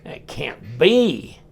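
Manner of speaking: drawn out, slowly doubting; stretched-out surprise; slow disbelief. Real, not acted. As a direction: say it slowly like you can't believe it. Surprise at the D-shaped pivot.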